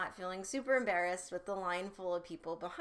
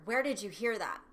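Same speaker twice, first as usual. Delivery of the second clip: louder, hit WHERE hard and drop; sharp accusing tone; 6 dB louder than usual. Shown as a demonstration. Parent snapping at a child's question, accusing. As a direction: demand it like an alarmed parent. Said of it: "is different than".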